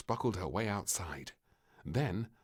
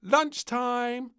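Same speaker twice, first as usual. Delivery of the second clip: high, loud call; 10 dB louder, much slower than usual. Acted. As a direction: call her in for lunch. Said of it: "called the maid".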